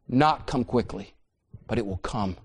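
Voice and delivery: usual speaking voice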